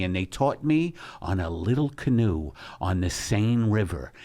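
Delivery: ordinary talk, nothing marked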